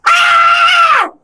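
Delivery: very high, loud held shriek; piercing mock toddler scream; imitated child's scream. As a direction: mimic a small child screaming at full pitch. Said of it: "I hear Bobby Becker go".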